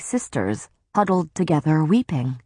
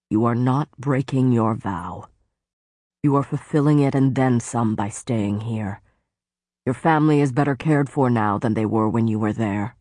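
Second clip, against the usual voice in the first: lower, grave warning in a lord's voice; lower stern faerie lord; 4 semitones lower than usual. Acted. Tamlin warning Feyre of the woods, low and grave. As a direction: warn her darkly, as the brooding lord.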